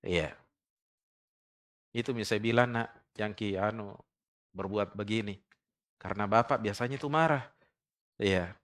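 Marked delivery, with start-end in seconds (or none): 2.7-7.5 put-on very soft, tender calming of a child; the mother soothes her child after the father's anger. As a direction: hug the child and calm them almost in a murmur. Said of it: "Penenang bagi hati anaknya".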